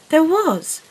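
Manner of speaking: put-on a girl insisting she is right; emphatic insistence. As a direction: insist it happened, hitting There. Insists on what she heard.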